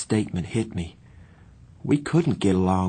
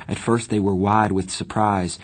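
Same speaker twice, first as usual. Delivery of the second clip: plain reading voice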